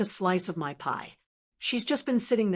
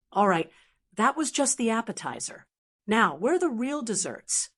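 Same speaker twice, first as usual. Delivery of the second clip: ordinary delivery, nothing marked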